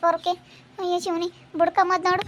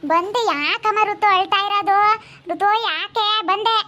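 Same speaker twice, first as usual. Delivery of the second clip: put-on high, drawn-out call to a child; 4 semitones higher, slower than usual. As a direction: stretch the words as you call over. Calling out to the crying child. (ಮಗುವನ್ನು ಎಳೆದು ಕೂಗಿ ಕರೆಯುವುದು)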